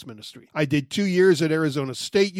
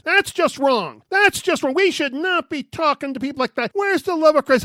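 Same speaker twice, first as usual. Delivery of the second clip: much higher, louder, indignant protest; 9 semitones higher, 6 dB louder than usual. Retold or imitated. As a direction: voice the shocked friend scolding him. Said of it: "did he take offense".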